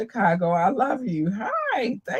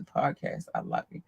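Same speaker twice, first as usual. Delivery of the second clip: deep mock voice; 8 dB quieter than usual. Mock deep love line.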